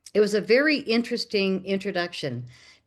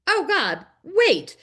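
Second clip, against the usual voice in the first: sudden excitement; 10 semitones higher, 5 dB louder, slower than usual. Retold or imitated. Shop lady suddenly remembers.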